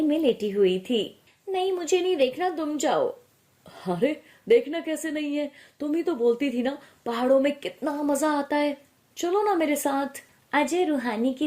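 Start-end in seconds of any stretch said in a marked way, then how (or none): 1.5-3.1 high, hurried brush-off; higher-pitched, whiny young wife; peevish refusal. Acted. Wife refuses husband from under quilt. 3.9-10.2 slightly put-on voice, raised pitch, insistent; a hint of an eager, teasing husband; lightly voiced coaxing husband.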